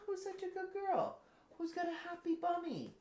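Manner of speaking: very high, soft cooing. Real, not acted. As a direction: coo over the puppy. Baby talk to dog.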